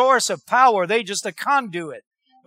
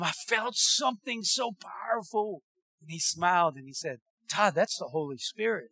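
hushed awe; 4 semitones lower, 10 dB quieter than usual. Retold or imitated. Hushed retelling of holy moment.

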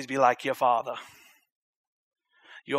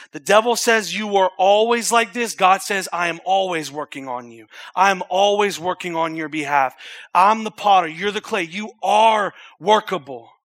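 preaching scripture; 3 semitones higher, 6 dB louder than usual. Real, not acted. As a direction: proclaim it with force. Preaching at full force.

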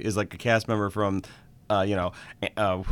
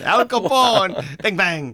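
high, loud, drawn-out fake Russian accent; mock Russian accent; 9 semitones higher, 5 dB louder, slower than usual. Co-host naming Chicago figures, mock Russian accent. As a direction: belt it in a silly Russian accent. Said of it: "It's Russian accent time".

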